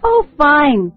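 ordinary talk, nothing marked

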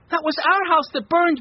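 put-on high, loud boy's cry; worked-up child's voice, loud and high; excited cry. A narrator's reading. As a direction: cry out the news, high-pitched. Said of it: "He cried".

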